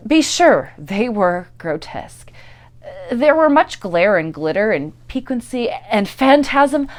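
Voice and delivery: ordinary delivery, nothing marked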